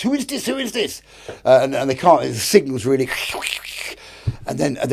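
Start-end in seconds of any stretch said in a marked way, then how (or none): 0.0-1.0 high, insistent imitated challenge; Spanish navy radio challenge. Spanish destroyer challenging an unknown boat, high mock radio voice. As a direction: demand it in a high, mock-urgent voice. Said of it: "Oh, this is in Spanish".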